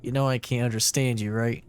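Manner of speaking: ordinary talk, nothing marked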